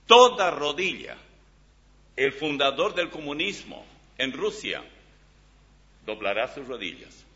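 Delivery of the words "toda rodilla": toda rodilla, hit hard and loud on both words; emphatic stress. Shown as a demonstration. Preacher to congregation, pounding out toda rodilla. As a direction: hammer home toda rodilla. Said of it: "Oiga bien".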